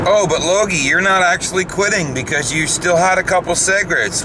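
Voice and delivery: high teasing mock voice; mock viewer.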